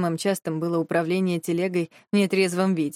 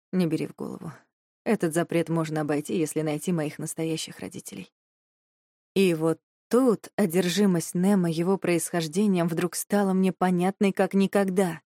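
usual speaking voice

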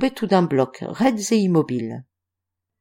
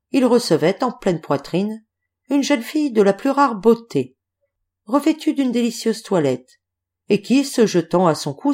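usual speaking voice

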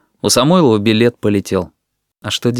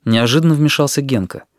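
usual speaking voice

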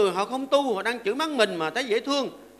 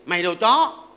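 louder, a mock snarl at the roommate; acted insult; 3 semitones lower, 6 dB louder, slower than usual. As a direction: spit it out as a petty insult.

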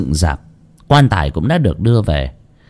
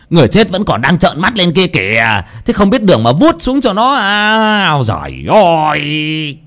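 scolding; 8 semitones higher than usual. Acted. Master scolds the father about the dead girl's eyes, high rebuke. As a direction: scold him for a foolish mistake. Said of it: "mà mắng ông Chí".

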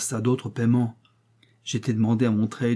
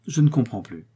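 ordinary delivery

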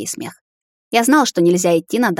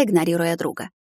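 plain reading voice

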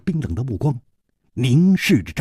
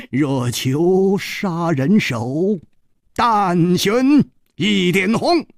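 slow, high, drawn-out couplet; smiling recitation; 9 semitones higher, 5 dB louder, much slower than usual. A narrator's reading. Hero quotes a saying to the killer, slow smiling recital. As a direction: intone the saying with a grin, stretching it. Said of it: "仍然带着微笑说".

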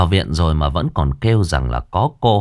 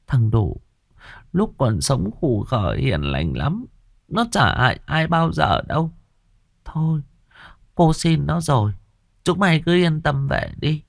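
put-on old woman soothing; 9 semitones higher than usual. A narrator's reading. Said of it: "quay sang nói với vợ chồng thằng nọ".

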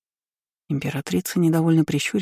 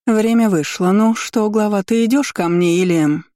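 lightly voiced empress, pitched up; higher, taunting ultimatum from a ruler enjoying her power; gloating empress; 6 semitones higher, 4 dB louder than usual. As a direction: press for an answer with a lilt.

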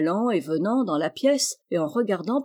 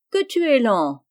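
raised, louder falling chide; friendly reproach; 4 semitones higher, 5 dB louder than usual. A narrator's reading. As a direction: chide him amicably for dawdling. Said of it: "fit le capitaine d'un ton d'amical reproche".

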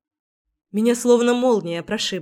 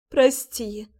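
sobbing apology; 2 semitones higher than usual. Acted. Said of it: "всхлипывая".

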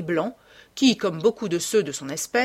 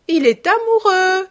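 high, loud, drawn-out cry; soaring, emphatic outburst; delighted exclamation; 13 semitones higher, 10 dB louder than usual. A narrator's reading. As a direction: cry it out, stretching the last word. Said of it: "s'écria lady Narborough".

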